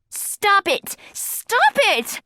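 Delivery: very high desperate yell; shouting. A narrator's reading. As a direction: cut the fight off with a cry. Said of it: "Emily shouted".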